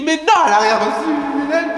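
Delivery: high, urgent retold protest; frantic insistence. Nephew insisting to uncle the money never came, high urgent. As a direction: insist in alarm.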